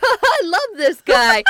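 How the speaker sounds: high, loud, drawn-out goofy voice; hammed-up cartoon fondness; gushing dimwit. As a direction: gush with dopey adoration. Skeeter gushes in retelling.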